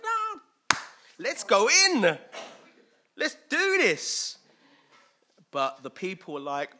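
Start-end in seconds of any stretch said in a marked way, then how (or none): 0.0-4.4 much higher, louder, drawn-out rallying cry; eager urging. Acted. Caleb and Joshua urging the people to go in, eager and loud. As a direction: cheer them on slowly and loudly.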